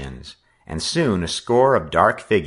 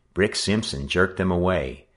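ordinary delivery

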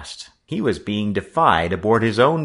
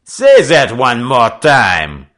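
slow, loud, high threat; bullying; 5 semitones higher, 11 dB louder, slower than usual. A narrator's reading. French skipper with fist raised to the boy, bullying. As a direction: dare him with a menacing French-accented challenge. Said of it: "French Pete bullied".

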